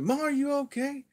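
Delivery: high-pitched call through a door. Retold or imitated. Calling to mom through door.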